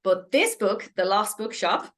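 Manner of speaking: normal talking voice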